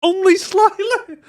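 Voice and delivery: high, loud slowed mock voice; squeaky mocking voice; mocking squeak. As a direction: squeak it out teasingly. Mocking repeat.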